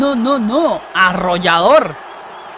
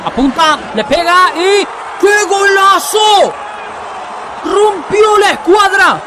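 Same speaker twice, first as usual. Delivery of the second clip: breakneck goal commentary; 10 semitones higher, slower than usual. Real, not acted. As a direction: call the goal in a rush of excitement. Commentating a top-corner goal. (narración de gol frenética)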